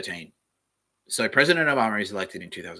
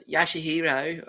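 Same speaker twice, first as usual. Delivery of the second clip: camp Aussie Bogan; 8 semitones higher, slower than usual. Shown as a demonstration.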